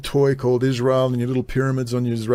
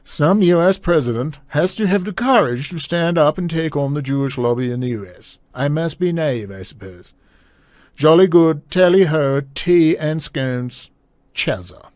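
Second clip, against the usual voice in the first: terrible put-on British accent, hammed up; mock royal letter-reading with exaggerated British vowels; Prince Charles; 2 semitones higher than usual. Host finishing and mocking the prince's letter, badly faked Prince Charles voice. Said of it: "Prince Charles voice".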